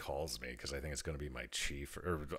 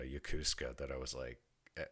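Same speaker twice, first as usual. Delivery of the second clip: normal talking voice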